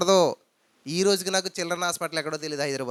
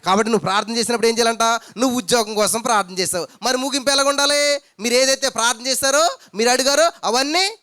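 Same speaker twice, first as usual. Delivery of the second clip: raised fervent preaching; preaching at full force; 4 semitones higher, 5 dB louder than usual. Acted. Preacher rousing the congregation, fervent and raised. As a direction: drive the point home to the church.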